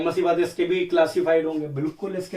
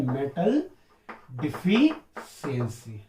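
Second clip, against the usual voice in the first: very slow drawn-out answer; 4 semitones lower, much slower than usual. Shown as a demonstration. Teacher repeating the term for pupils, slow and drawn out. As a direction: stretch deficiency out slowly and lower. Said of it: "कौन सा है?".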